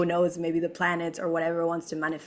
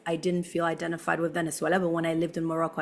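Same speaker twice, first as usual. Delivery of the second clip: ordinary delivery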